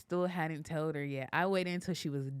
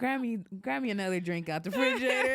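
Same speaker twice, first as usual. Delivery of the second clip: higher, louder and fast, laughing through it; laughing mock panic; 7 semitones higher, 6 dB louder, faster than usual. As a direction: imitate mom needing a drink right now. Mom stunned by pregnancy news.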